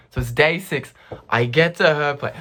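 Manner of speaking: usual speaking voice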